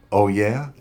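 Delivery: loud tough "oh yeah"; loud challenge. Acted. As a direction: dare him to try. Detective challenges the punk.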